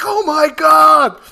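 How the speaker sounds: high, drawn-out shout of disbelief; astonished crowd yell. Retold or imitated. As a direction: shout it like a stunned cinema audience. Retelling cinema crowd's shocked reaction.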